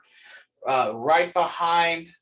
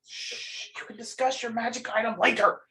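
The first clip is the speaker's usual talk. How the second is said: long shush, then quick higher scolding; playful shushing; 6 semitones higher, 4 dB quieter than usual. Acted. Game master shushes the chattering players, playful. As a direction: hush them, then hurry through the telling-off.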